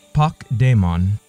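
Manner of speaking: usual speaking voice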